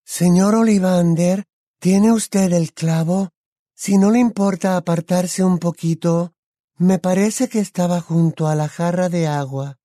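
Luna. A narrator's reading. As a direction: call politely, stretching the name. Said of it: "le oyó decir Harry a Luna".

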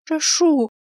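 high trembling begging; desperate plea. A narrator's reading. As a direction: plead in fear. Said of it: "дрожащим голосом зачем-то попросил я".

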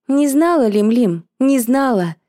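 high, loud indignant retort; indignant outburst. Acted. Indignant reply to friend's question.

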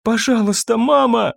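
usual speaking voice

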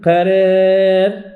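قرار drawn out slowly, long a bent toward e; imala on قرار. Shown as a demonstration. Teacher models imala on قرار for students. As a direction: stretch قرار with its alif tilted toward e. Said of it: "كذلك".

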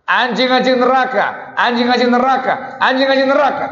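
high and loud, repeated like a verdict; sharp condemnation. Retold or imitated. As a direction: hurl the words anjing-anjing neraka. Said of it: "Itu bahasa yang sangat tajam". (kecaman tajam dengan suara tinggi dan keras)

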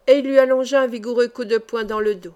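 ordinary delivery

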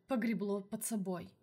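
usual speaking voice

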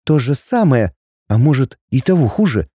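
ordinary delivery, nothing marked

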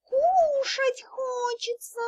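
high, drawn-out begging squeak; plaintive kitten. A narrator's reading. Hungry kitten begs the dragon, plaintive and drawling. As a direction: whine it slowly like a hungry kitten. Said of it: "жалобно так протянула".

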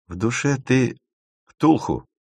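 sarcastic. Acted. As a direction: tease with dry sarcasm, pausing before the last word. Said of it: "сказала Эля с сарказмом". (с сарказмом)

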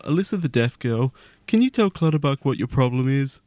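normal talking voice

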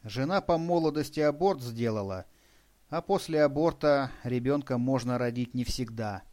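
ordinary delivery, nothing marked

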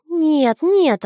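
высокое громкое детское «нет»; упрямо отказывается, как девочка; упрямый ребёнок. A narrator's reading. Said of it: "она упрямо затрясла головой". (упрямый детский голос)

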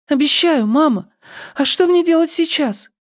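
put-on very high girlish voice; high, childlike questioning; girl's voice. A narrator's reading. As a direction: answer mama like an obedient girl. Girl answers her mother.

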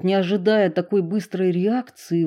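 usual speaking voice

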